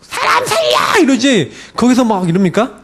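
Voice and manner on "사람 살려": very high, drawn-out cry for rescue; desperate scream. Shown as a demonstration. Preacher acts a drowning person crying out to be saved, desperate scream. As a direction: shriek slowly and high for help. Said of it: "막 소리 지르고 난리 나잖아요".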